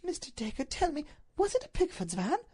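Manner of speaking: high, pleading lady's voice; high-pitched anguished appeal; anguished Duchess. Acted. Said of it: "In her voice there was a ring of anguish".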